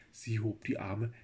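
plain reading voice

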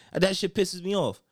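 ordinary delivery

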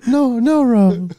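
high, slow creepy cartoon voice; weird character voice. Podcaster hamming up his chip refusal, weird character voice. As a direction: stretch it out as an odd high character.

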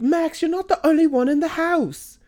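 exasperated mom parody, high and sharp; imitating the mom. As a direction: pitch it way up and mock the nagging mom. Mom scolding kid, quoted.

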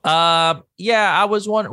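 high, hesitant customer voice; role-play caller. Pretend. Caller about to order from the diner, high and hesitant. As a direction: pitch it up, feeling out the order. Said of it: "Okay, I'ma call in".

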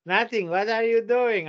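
mock innocent mother; mimicking the mother. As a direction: act like nothing is going on.

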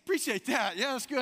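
high, loud polite brush-off; awkward thanks. Retold or imitated. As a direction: chirp the thanks to end it fast. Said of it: "I said, I said".

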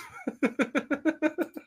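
real high-pitched giggle; high giggling laugh. Real, not acted. Podcast host amused by a viewer's comment, giggling. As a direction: burst into helpless high giggles.

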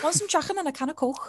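put-on [name] tries her Liverpudlian accent on her cohosts. Lightly done Liverpudlian accent. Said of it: "That did sound kinda good".

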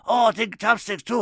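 loud, high-pitched Japanese accent impression; hammed-up Japanese accent, shouted and high; mock Japanese accent. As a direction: answer as an exuberant Japanese man. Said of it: "He was Japanese".

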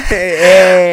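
excited laughing shout. Real, not acted. Laughing, stunned at the story.

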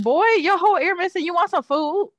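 high, loud exasperated pet owner; mock scolding. Owner retelling waking to the puppy's missing ear, mock scolding.

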